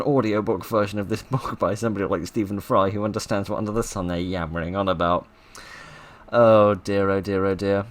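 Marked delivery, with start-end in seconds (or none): none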